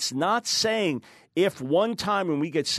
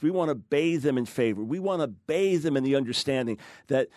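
normal talking voice

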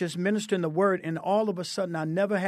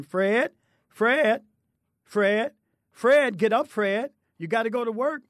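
raised, higher calls of the name up the stairs; grandma calling upstairs; 6 semitones higher, 4 dB louder than usual. Retold or imitated. Grandma calls up the stairs to wake Fred, raised call. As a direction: call him awake from the bottom of the stairs.

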